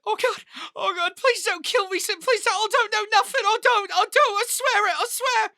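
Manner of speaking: very high-pitched panicked begging; crying and pleading. Acted. Captive pleading with the marshal not to kill him, crying. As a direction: beg for your life through tears. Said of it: "He's crying, and he's just like".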